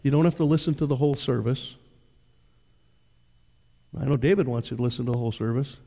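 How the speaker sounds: ordinary delivery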